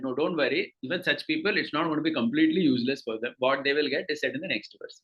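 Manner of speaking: normal talking voice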